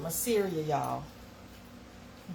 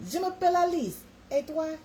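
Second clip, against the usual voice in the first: even higher, lilting mock French; schoolbook French; 10 semitones higher than usual. Shown as a demonstration. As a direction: show off high-school French, sweeter. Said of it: "French was mine".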